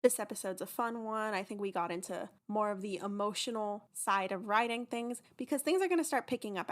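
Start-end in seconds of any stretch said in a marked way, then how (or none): none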